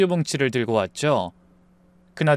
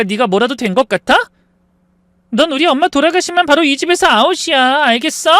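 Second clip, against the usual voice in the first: high, loud, contemptuous scolding; angry rant; 9 semitones higher, 5 dB louder than usual. Retold or imitated. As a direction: sneer her down, louder and higher as it goes. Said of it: "하면서 화를 내더라고요". (높고 크게 깔보며 화내는 딸)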